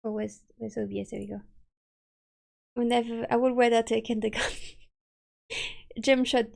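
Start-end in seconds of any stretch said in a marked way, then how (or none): none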